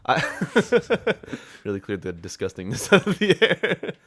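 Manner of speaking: real laughter breaking into the words, drawn out; laughing. Real, not acted. Joking with a co-host about clearing the throat, laughing.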